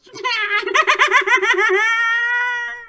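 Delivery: very high, loud witch cackle. Acted. Witch laughs after greeting.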